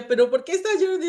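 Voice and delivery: high, quick quoted question; mock puzzled questioner. Acts out someone questioning her crying.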